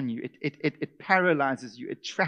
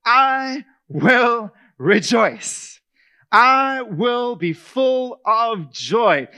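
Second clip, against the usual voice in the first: slow, loud, high proclamation; sermon peak, drawn out and ringing; preaching; 6 semitones higher, 9 dB louder, much slower than usual. Acted. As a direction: declare it slowly with full force.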